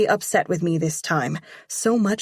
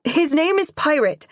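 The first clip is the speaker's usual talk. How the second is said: eager rush; 10 semitones higher, 4 dB louder than usual. Acted. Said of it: "talking so fast my tongue".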